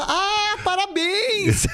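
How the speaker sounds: high, drawn-out mock congratulations; retold cheer. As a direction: stretch it out like the whole family cheering. Family cheers at the birth.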